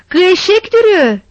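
furious husband yelling at the locked door; angry shout. Acted. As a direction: bark the insult at her. Husband scolding the missing wife.

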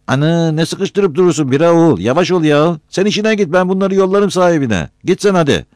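high, loud rebuke; impatient, high-voiced telling-off; irritated scolding. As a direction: shoo someone away, loud and high-pitched. Hoca snaps at interrupting visitor.

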